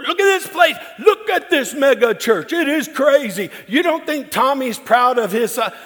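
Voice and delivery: high, loud, gushing uncle voice; bragging uncle. Retold or imitated. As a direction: crow about the family like a proud old uncle. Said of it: "So he goes".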